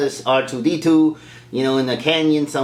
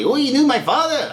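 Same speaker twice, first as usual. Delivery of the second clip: high, eager question; imitated excited kid asking; eager Luke; 11 semitones higher than usual. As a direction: pipe up hopefully, pitched way up. Excited Luke voiced in retelling.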